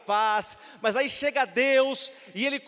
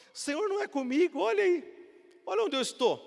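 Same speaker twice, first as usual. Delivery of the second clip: complaining Gideon; 8 semitones higher than usual. Retold or imitated.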